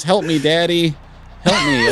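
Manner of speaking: put-on high, thin child's plea; child crying for help. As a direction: cry out for daddy like a little kid. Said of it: "he just hears".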